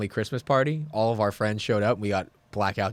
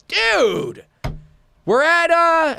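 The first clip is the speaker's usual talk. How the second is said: excited relief; 6 dB louder, much slower than usual. Retold or imitated. Excitedly giving the address.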